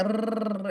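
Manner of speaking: long stretched trill on রর; exaggerated r. Shown as a demonstration. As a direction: overdo the rolled r in রর. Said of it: "এরকম করতে থাকি". (রর-এ র অতিরিক্ত টানা)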